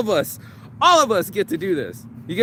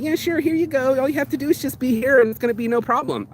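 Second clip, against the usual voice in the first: higher, fast, breezy mock reassurance; easy promise; 8 semitones higher than usual. Vlogger mocking life's easy promise, high breezy voice. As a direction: sell the easy road cheerfully. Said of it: "it's gonna just be like".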